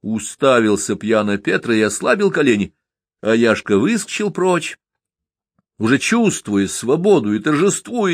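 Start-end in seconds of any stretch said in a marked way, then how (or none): none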